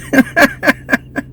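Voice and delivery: short high laugh; giggle. Real, not acted. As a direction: laugh at your own line. Laughing at her own joke.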